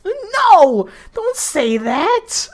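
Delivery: high outburst cracking into laughter; squealed objection; shocked laughing protest. Real, not acted. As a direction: wail it, on the edge of cracking up.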